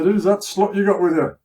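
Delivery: high, loud jeer across the street; mocking yell at a passerby; taunting shout. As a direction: sneer it loudly at him. Jeering soldier insults girlfriend.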